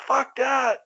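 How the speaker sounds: high-pitched, panicky refusal in retelling; mock scared refusal. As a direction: act out a kid balking at a dare. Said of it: "I was scared to death".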